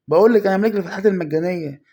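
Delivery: loud, fast triumphant boast; excited shout. Real, not acted. Gamer boasting to viewers, loud and fast. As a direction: yell it like you just pulled a star.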